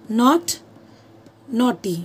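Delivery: not and naughty said apart, stressing the n; word by word. Shown as a demonstration. Teacher showing students the shared n sound. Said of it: "मैं आपको बोलती हूँ".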